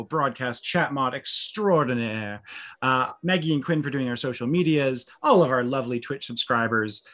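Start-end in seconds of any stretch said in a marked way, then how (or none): none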